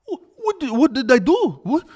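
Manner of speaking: high-pitched, stammering imitation of wronged innocence; mock innocent bewilderment. Imitating fighter after he fouls, mock innocent bewilderment. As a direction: squeak it out as if honestly baffled. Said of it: "He does it so honestly and sincerely".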